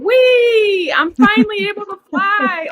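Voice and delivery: high, elated mock flying cry; imitated joyful squeal. Daughter voicing her mother finally able to fly, elated. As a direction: squeal it like someone taking flight.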